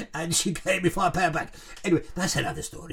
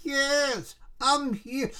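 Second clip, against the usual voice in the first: higher, distant spirit voice; ghostly voice from nowhere; 8 semitones higher, much slower than usual. Retold or imitated. Ghost answering the widow at the seance, eerie voice. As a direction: call out faintly like a ghost. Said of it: "he spoke like that".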